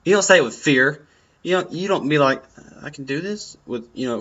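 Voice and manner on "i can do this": softer, doubtful, rising at the end; fearful, questioning. Shown as a demonstration. Showing the wrong way to psych up, unsure and scared. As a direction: ask it like you don't believe it. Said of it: "questioning yourself and fearing yourself".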